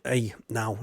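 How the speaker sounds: normal talking voice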